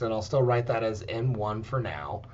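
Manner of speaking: ordinary delivery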